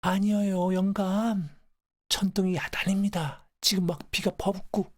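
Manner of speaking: put-on high-pitched urgent old wife; pleading grandmother. Wife assures her dying husband it is a thunderstorm, urgent pleading. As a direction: plead insistently, pitched well up.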